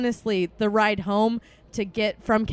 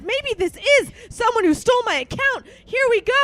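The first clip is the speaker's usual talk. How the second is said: much higher, loud thrilled yell; excited shout; 13 semitones higher, 8 dB louder than usual. Retold or imitated. Hoping a thief stole her account, shouting. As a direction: shout it like a fraud-hunter.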